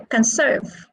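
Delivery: "conserve" stressed on SERVE; stress on second syllable. Shown as a demonstration. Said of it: "It is on the second syllable".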